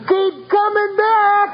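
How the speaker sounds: high, slow, drawn-out yell; yelled call-out. Retold or imitated. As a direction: call it after him as he flies out the door. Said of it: "yell out".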